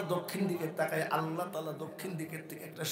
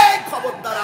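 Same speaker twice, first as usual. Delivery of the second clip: loud, raised-pitch warning; acted sharp warning; 7 semitones higher, 10 dB louder, slower than usual. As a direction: call it out as a stern warning. (চড়া গলায় সাবধান করার অভিনয়)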